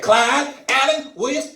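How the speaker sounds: high, loud call of the full name; mom calling full name. Retold or imitated. Mom calling her son by his full name, loud. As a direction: scold like a mother summoning. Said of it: "she started calling, like, my whole name".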